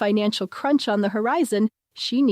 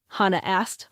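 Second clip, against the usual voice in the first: plain reading voice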